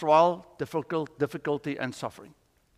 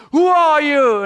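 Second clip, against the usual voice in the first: high, loud call to an unseen voice; shouted question; 9 semitones higher, 9 dB louder, slower than usual. Retold or imitated. Hanging man questions the voice above, shouting.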